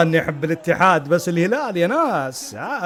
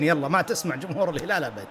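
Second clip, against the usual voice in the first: usual speaking voice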